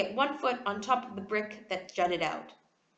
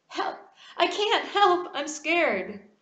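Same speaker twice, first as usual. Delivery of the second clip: scared pleading; 7 semitones higher, 5 dB louder, slower than usual. A narrator's reading. Boy hanging from a window.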